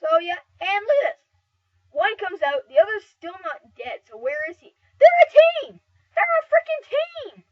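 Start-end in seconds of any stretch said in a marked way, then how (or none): 5.0-7.5 furious high shout of disbelief; raging. Real, not acted. Gamer yelling that two rivals secretly teamed up, furious shout. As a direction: yell it out, outraged at being ganged up on. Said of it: "I'm raging right now".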